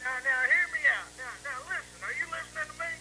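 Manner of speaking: ordinary talk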